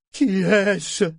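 high, bewildered cry; distraught. A narrator's reading. Blinded ship startled by a stranger, distraught high question. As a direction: blurt it out, lost and alarmed. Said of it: "demanda-t-il éperdu".

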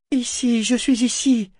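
high, carrying call; calling to guide. A narrator's reading. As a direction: call out so they can find you. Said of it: "appela-t-elle pour le guider".

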